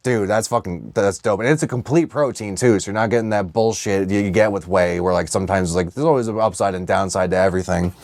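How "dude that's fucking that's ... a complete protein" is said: normal talking voice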